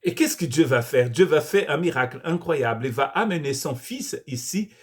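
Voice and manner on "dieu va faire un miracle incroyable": usual speaking voice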